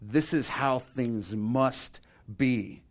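slow, deliberate weight on every word; emphatic. Retold or imitated. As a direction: stretch "must" and pause before "be". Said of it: "That's his emphasis".

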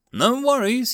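bright high cheerful reassurance; cheerfully. A narrator's reading. Goblin brushing off the human's condolences, cheerful. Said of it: "he said cheerfully".

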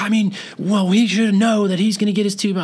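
high-pitched mock objection; indignant daughter. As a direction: imitate a put-out relative protesting. Daughter objects in retold argument.

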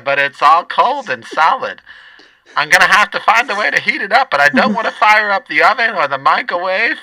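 high, loud lunch lady; brash cafeteria lady scheming. Acted. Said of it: "I should just do both voices".

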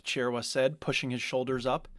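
usual speaking voice

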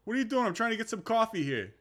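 high, loud mock-angry New York accent; hammed-up New York-Jersey accent, annoyed; mad New York accent. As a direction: gripe like an irate New Yorker. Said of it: "try and sound mad".

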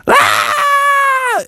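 very high shrieking cry; a frog chased by a wolf; frog scream. Acted. Froggy screams.

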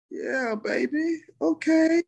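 high, drawn-out humoring mom; imitating unsure mom. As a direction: placate them slowly in a high mom voice. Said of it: "didn't probably know how to respond".

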